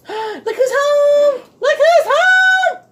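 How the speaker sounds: high, drawn-out excited greeting; welcoming someone home. Real, not acted. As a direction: squeal it out, overjoyed. Partner arrives home, excited greeting.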